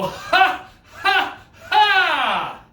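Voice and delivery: loud, high demonstrated belly laugh; big "ha" laugh. Shown as a demonstration. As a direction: belt out big, playful ha-ha-ha. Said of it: "everyone go".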